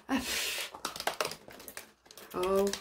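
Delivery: ordinary talk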